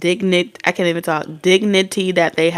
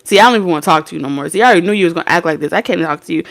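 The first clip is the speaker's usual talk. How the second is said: fast sulky mumble; much faster than usual. Retold or imitated. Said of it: "this person is like".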